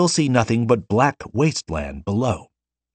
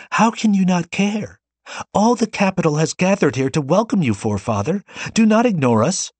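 tearful wail; 11 semitones higher than usual. A narrator's reading. Girl begging the Forerunner not to leave, tearful wail. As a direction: plead through tears. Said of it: "the girl cried, emitting a tearful wail".

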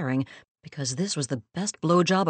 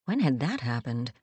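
usual speaking voice

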